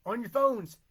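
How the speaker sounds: high, loud call to the viewers; loud hype plug. Real, not acted. Host urging viewers to hit share, loud hype plug. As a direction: punch it out like a hype man.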